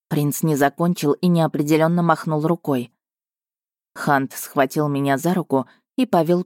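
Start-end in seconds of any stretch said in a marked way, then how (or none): none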